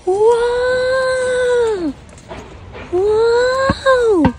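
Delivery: very high amazed wow; delighted wow. Real, not acted. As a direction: gasp out wonder at the surprise. Surprise reveal to baby.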